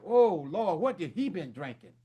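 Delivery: low, soft, muttered mock aside; hushed imitation of a doubtful audience. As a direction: quietly voice the baffled listener. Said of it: "Y'all like".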